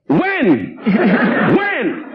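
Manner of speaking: mock wives. Comedian mimics wives on the golf course, rising plea. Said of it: "they stood on the course and go".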